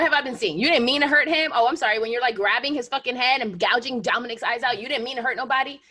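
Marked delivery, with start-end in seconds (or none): none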